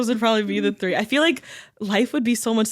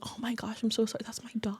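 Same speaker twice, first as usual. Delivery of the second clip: soft apologetic; 14 dB quieter, faster than usual. Retold or imitated. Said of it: "she was like".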